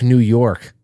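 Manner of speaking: ordinary talk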